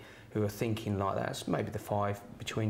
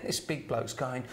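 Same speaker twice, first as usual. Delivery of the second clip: ordinary talk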